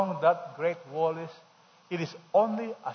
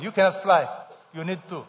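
ordinary delivery

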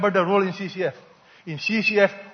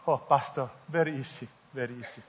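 mildly demure widow; 6 semitones lower, 10 dB quieter than usual. Acted. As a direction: lower and softer, lightly voiced widow. Widow answers the pastor in joke.

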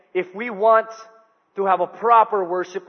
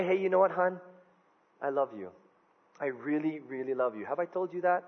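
softer, intimate tender voice; 3 semitones lower, 10 dB quieter than usual. Retold or imitated. Husband to wife, softly tender I love you. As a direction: murmur it lovingly to her.

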